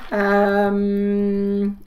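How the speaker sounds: ordinary delivery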